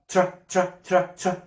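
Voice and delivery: ordinary delivery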